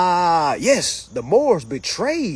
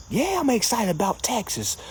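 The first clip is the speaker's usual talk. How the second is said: usual speaking voice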